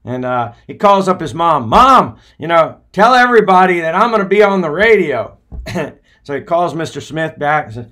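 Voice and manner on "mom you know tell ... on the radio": high, loud, fast burst of joy; excited call home. Retold or imitated. Les phoning his mom about the radio, excited. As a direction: tell mom the big news.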